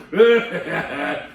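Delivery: loud falling guffaw. Acted. Elephant guffaws and chortles, a loud falling guffaw. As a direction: let out a big guffaw. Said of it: "He giggled and he guffawed".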